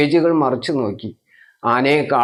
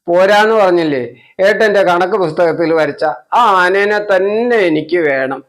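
higher, louder whining tantrum; stubborn crying child; 4 semitones higher, 5 dB louder than usual. Acted. Child to mother, higher, louder tearful tantrum. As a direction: stamp your foot, wail the demand. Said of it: "വാശി കൂടി കുട്ടി കരച്ചിലും".

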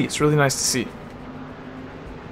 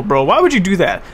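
usual speaking voice

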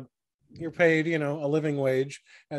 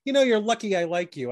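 chief's warning; 6 semitones higher, 3 dB louder than usual. Acted. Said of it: "he says".

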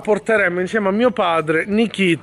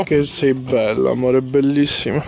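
tender; 7 semitones lower than usual. Real, not acted. Tender words to dog.

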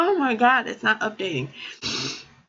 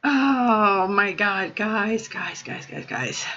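fading choked-up; 6 semitones lower, slower than usual. Real, not acted. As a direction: trail off into a tearful hush. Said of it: "overwhelmed by gifts".

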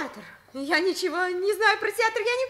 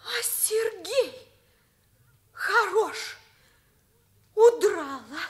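normal talking voice

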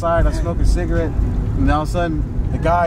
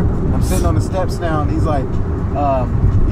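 ordinary delivery

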